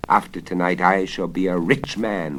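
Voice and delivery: gloating. Acted. Wizard gloats over wealth.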